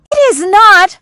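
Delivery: high, loud outburst; loud cry. Acted. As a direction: cry it out in protest. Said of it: "I cried".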